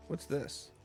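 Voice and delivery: ordinary talk, nothing marked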